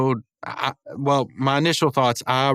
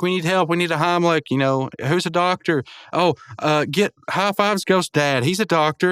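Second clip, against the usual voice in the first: higher-pitched mock alarm; retold urgency; 6 semitones higher than usual. Friends seek a doctor for choking, imitated urgency. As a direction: call for help, lightly panicked.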